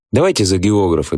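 usual speaking voice

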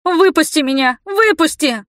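high, pleading outcry; panicked cry. A narrator's reading. Heroine pounding on the door at her captor, panicked cry. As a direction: cry desperately to be let out. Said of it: "забарабанила с криком".

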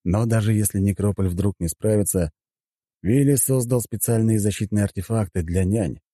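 ordinary delivery

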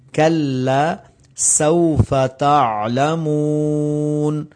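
کلا سوف تعلمون recited slowly with a clear k and a whistling س; slow recitation. Shown as a demonstration. Teacher reciting the verse correctly for students. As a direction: stretch it out melodically. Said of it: "عین کے اوپر قلقلہ نہیں کرنا".

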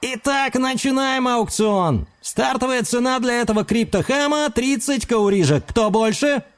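high, bright auctioneer call. A narrator's reading. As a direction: open the auction like a showman. Auctioneer opening the bidding.

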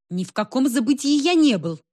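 indignant. A narrator's reading. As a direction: protest with mild indignation. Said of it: "возмутился Павел Зигмунтович".

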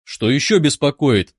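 ordinary delivery, nothing marked

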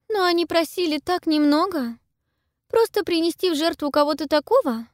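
put-on high, cold girl's voice; proud girl voice. A narrator's reading. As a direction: coolly justify the sacrifice. Pippa's voice.

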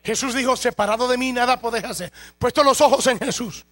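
loud, driving sermon delivery with slow emphatic stretches; fervent preaching. Acted. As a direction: preach it out full force to the congregation. Preaching at full force.

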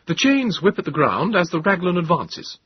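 put-on loud, high young officer; clipped officer. A narrator's reading. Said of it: "In clipped tones".

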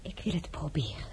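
a soft, unvoiced reluctant agreement; hesitant whisper. The princess quietly whispering her consent to the envoys. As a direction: barely whisper your consent. Agreeing after long pause.

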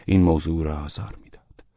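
ordinary delivery, nothing marked

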